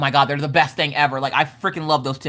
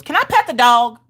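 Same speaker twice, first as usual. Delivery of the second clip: put-on little girl voice; 7 semitones higher, 5 dB louder than usual. Mom voicing her little daughter.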